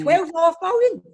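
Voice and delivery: high, loud disbelieving echo; shocked repeat of the price; how much, really?; incredulous. Real, not acted.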